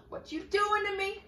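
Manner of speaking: real exasperation, high and loud; exasperated high cry. Real, not acted. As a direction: yelp at the phone in exasperation. App disconnects mid song.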